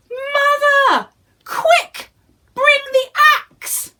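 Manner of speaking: very high, loud urgent shout; Jack shouting. Acted. Jack shouting to his mother to bring the axe. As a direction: yell for the axe. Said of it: "he shouted".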